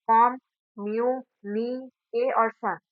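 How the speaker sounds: "कॉम, म्यू, नी, ए और शन" split into separate syllables with pauses. Shown as a demonstration. Teacher counting out the syllables of communication, "कॉम, म्यू, नी, ए और शन" spoken syllable by syllable. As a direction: sound out "कॉम, म्यू, नी, ए और शन" one syllable at a time. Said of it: "कम्युनिकेशन में पांच syllables हैं".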